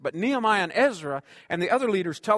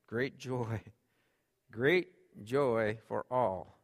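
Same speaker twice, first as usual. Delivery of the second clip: drawn-out, lower, savoring each word; savored slowly; 5 semitones lower, 5 dB quieter, much slower than usual. Acted. Preacher dwelling on the promise, very slow and savoring. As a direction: stretch it out like tasting it.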